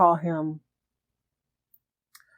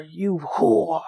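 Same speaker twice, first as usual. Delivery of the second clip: contemptuous insult; 2 semitones lower than usual. Retold or imitated. Boyfriend insults her after fight.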